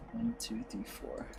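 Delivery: counting to herself in a whisper. Real, not acted. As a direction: breathe the numbers out as she counts. Counting spaces under her breath.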